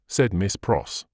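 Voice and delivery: plain reading voice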